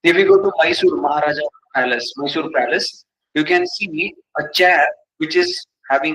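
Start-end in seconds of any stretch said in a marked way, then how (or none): none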